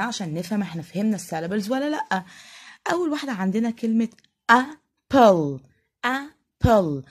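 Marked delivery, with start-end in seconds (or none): 4.5-7.0 "apple" as A - PPLE, two drawn-out beats; teacher sounding out "apple" in two beats; split into two syllables. Shown as a demonstration. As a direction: break "apple" in half with a clear gap. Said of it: "على مرتين".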